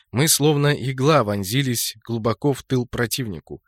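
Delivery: ordinary delivery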